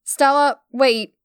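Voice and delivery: loud call after her. A narrator's reading. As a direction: call out as she leaves.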